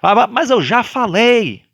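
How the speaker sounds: high, impatient complaint; mock exasperation at repeating oneself; irritated repeat. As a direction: huff as if tired of repeating. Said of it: "tem gente que tem raiva".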